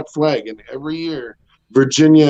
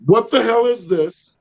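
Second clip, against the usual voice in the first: high yell at the dogs; 8 semitones higher than usual. Retold or imitated. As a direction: scold the dog from across the yard. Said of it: "the three things that I pretty much yell".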